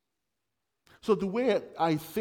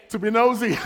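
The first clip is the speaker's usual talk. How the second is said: louder, higher, delighted echo; amused repeat; 6 semitones higher, 10 dB louder than usual. Real, not acted. Preacher echoing a congregant's funny answer loudly. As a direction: echo the answer back with glee.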